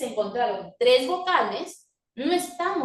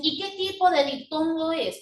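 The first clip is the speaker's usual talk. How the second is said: fussy examiner; 5 semitones higher than usual. Retold or imitated. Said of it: "si San Marcos se pone exquisito y te dicen".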